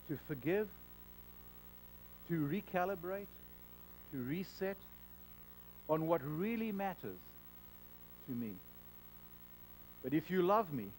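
low, soft, measured command; hushed low Jesus telling the quarreling believers to reconcile. Pretend. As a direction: speak gently but with authority. Jesus voiced in imagined scene.

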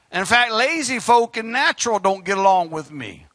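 ordinary talk

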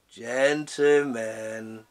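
very slow, low, drawn-out; sinister drawl. Acted. The innkeeper calls through the door, sinister. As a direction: stretch it like a threat.

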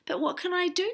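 helpless, high-pitched appeal; crying out helplessly. A narrator's reading. Tom pleading to the townsfolk, helplessly. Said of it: "cried Tom".

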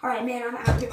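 normal talking voice

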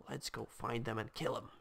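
ordinary delivery

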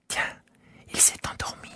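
barely voiced whisper; child hushing over a sleeping sheep; soft whisper. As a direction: whisper so the sheep stays asleep. Prince sees sheep sleeping.

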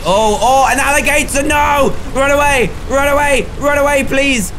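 loud high yells of mock panic; fleeing an alligator in a hammed-up panic; panicked shouting. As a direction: shout it while running for your life.

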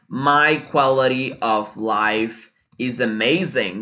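very slow, word by word; slow "My quality of life is amazing"; slow model sentence. Shown as a demonstration. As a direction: dictate it for learners. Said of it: "For example".

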